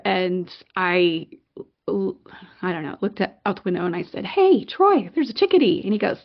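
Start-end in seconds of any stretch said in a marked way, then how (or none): 4.2-5.8 high, louder excited call; pitched-up "look at this!" shout. Retold or imitated. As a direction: call out a discovery eagerly. Child calling brother to see bird.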